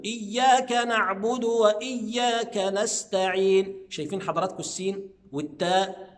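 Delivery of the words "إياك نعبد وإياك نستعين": slow drawn-out "إياك نعبد وإياك نستعين"; correct recitation. Shown as a demonstration. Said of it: "بس كل الحكاية إن أنا".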